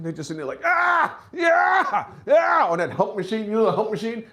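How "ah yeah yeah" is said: high, straining mock grunts; loud workout grunts. Guy on the hump machine, hammed-up straining groans. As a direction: grunt like you're lifting huge weight. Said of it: "making mad noise for no reason".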